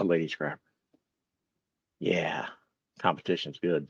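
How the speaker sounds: normal talking voice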